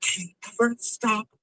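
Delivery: put-on high, whiny complaining girlfriend; mock woman. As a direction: pitch up and whine about commitment. Woman complaining about boyfriend.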